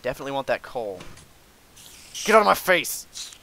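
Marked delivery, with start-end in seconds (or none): 2.2-3.3 high, loud order to back off; sudden irritated yell; angry shout. Real, not acted. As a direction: yell it at an attacker. Enemy attacks up close.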